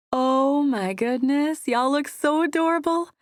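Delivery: high, loud, delighted squeal; gushing. A narrator's reading. Paige gushing at the couple on the deck, high and excited. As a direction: coo over the cute couple.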